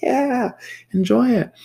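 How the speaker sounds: high, loud sing-song "yeah, enjoy it"; gushing high voice. Retold or imitated. Gushing high to the girl holding her candy bar. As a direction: gush like you're delighting a child.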